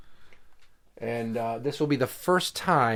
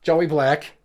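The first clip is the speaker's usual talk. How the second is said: loud announcer name call; grand entrance announcement; 3 semitones higher, 4 dB louder than usual. Acted. Announcing the match.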